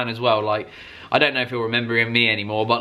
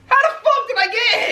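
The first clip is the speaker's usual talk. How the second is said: laughing disbelief; 3 dB louder than usual. Retold or imitated. Incredulous retold inner thought.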